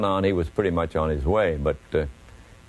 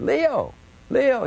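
much higher, thin squeaky voice. Retold or imitated. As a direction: squeak out the name like a pleading rookie. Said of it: "in that high, squeaky voice".

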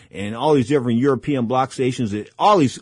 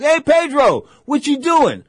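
much higher, louder, a hammed-up boxing promoter's greeting; Don King voice; 13 semitones higher, 5 dB louder, slower than usual. Don King calling Pedro over, in a high hammed-up greeting. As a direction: play the big boss calling his guy over. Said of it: "He says".

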